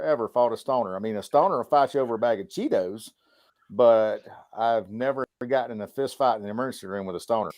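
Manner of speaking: normal talking voice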